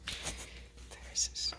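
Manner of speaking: slow hushed whisper near sleeping dog. Real, not acted. Owner whispering so the dog stays asleep. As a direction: breathe it out softly. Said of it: "We gotta step ever so quietly".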